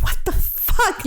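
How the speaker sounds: startled hushed what the fuck; blurting it out in alarm; shocked. Retold or imitated. Mother hearing her daughter wail, startled hush. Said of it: "I was like".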